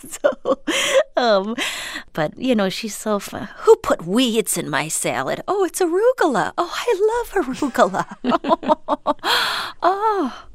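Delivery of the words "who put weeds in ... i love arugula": hammed-up mom, swooping much higher and louder; mother impression. Daughter imitating her mom over salad, high gushing mother voice. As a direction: gush high as a delighted mother. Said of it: "I love how you do her voice".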